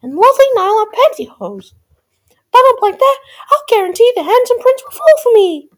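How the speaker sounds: high, loud, eager princess squeal; Cindy's wishing voice. A narrator's reading. Cindy pleads with the fairy for finery, high and loud. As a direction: belt out the wish in a high, girlish character voice.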